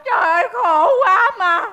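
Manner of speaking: crying shout with stretched last words; loud wailing. Acted. Woman wails outside the café, loud sobbing lament. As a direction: bawl out your misery theatrically. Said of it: "mày khóc lóc um sùm".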